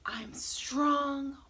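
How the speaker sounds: secretive little mantra; whispered affirmation. Shown as a demonstration. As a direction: whisper it to the children. Recites colour affirmation in whisper.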